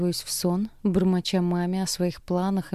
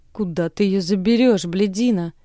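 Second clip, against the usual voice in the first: put-on louder slurred drunk father; drunken drawl; 5 dB louder than usual. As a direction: drag it out drunkenly. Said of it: "тянет пьяным голосом отец".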